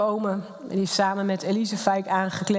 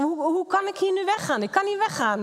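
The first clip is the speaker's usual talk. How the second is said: anguished protest; 8 semitones higher than usual. Retold or imitated. Retold despair at leaving husband.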